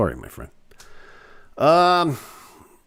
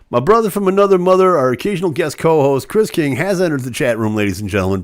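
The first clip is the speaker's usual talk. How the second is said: higher, louder hype-man welcome; announcer intro; 3 semitones higher, 4 dB louder than usual. Acted. Host welcoming his guest co-host to the chat, announcer hype. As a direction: announce him like a ringside MC.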